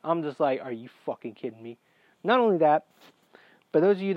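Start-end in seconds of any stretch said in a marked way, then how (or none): 0.6-1.8 low, quick, quiet exasperation; muttered under the breath, salty. Retold or imitated. Patient seeing the bloody scalpel, salty. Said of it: "So I'm salty at this point".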